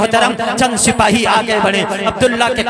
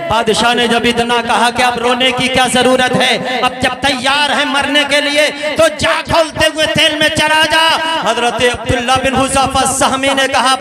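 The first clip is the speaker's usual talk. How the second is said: ordinary talk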